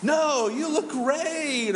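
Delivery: high, drawn-out gushing reassurance; hammed-up, slow "no" of reassurance. Friend answering someone fishing for a compliment, gushing. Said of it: "they want you to say what".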